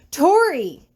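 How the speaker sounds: high startled squeal. Acted. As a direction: yelp her name in alarm. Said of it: "Daniel squeals".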